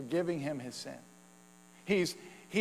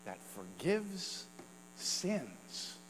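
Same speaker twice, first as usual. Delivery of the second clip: soft, slow awed asking; hushed wondering question; 4 semitones higher, 11 dB quieter, much slower than usual. Pretend. Crowd wondering aloud about Jesus, hushed and slow. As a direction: ask it slowly under your breath in wonder. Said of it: "might ask this question".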